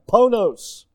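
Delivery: "Ponos" said clearly as a Greek word. Shown as a demonstration. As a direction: say "Ponos" as PO-nos, stressing the first syllable. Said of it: "It even sounds like pain".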